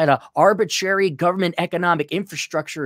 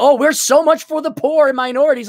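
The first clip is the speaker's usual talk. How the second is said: sarcastic mock quote; 9 semitones higher, 5 dB louder than usual. Mocking leftists' claim.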